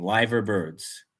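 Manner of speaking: "Liver" with a long i, lyver, slowly. Shown as a demonstration. Streamer confirms to chat, "Liver birds" slow as lyver. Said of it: "I understand now what you're saying".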